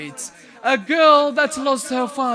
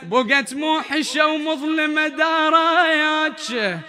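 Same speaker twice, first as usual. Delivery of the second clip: elegiac poetry recital; 4 dB louder than usual. Acted. Reciter mourning Zainab's empty house, grieving chant. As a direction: linger on each call of the house.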